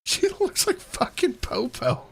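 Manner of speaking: squealing through laughter; high laughing squeal. Real, not acted. Laughing at video.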